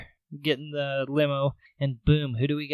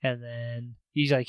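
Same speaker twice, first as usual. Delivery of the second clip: ordinary talk, nothing marked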